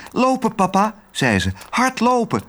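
put-on high, coaxing girl voice; sweet little girl. A narrator's reading. Otje coaxing Herman, high and sweet. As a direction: charm the dog gently.

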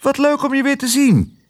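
hoge, opgetogen vogelstem; roepend musje. A narrator's reading. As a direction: begroet hoog, als een blij musje. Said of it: "riep ze". (hoog, blij mussenstemmetje)

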